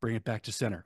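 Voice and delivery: quietly telling himself to calm down; calming myself. Real, not acted. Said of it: "slow down, D C. Calm down".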